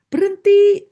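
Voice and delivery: desperate demon screams stop; shrieking demon. Retold or imitated. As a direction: shriek it like a tormented spirit. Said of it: "dia tiba-tiba teriak".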